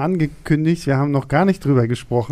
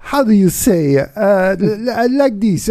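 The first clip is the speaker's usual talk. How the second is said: put-on higher, hammy Italian-accented English; Jeremy Irons Italian accent; 5 semitones higher, 4 dB louder, slower than usual. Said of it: "Jeremy Irons hat zum Beispiel so einen Moment".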